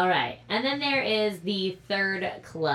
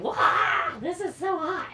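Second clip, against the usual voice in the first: high-pitched surprised self-quote; mock surprised exclamation; 11 semitones higher, slower than usual. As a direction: gasp it out in delight. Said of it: "It was like".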